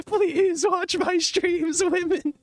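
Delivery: high pleading voice. Pretend. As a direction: beg in a squeaky falsetto. Pleading to women viewers.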